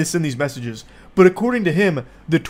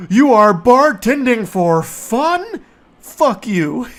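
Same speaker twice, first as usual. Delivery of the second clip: much higher, slow and louder disbelief; incredulous; 6 semitones higher, 5 dB louder, slower than usual. Real, not acted. Reacting to the bartender's reply, higher and outraged. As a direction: squeal it in outrage.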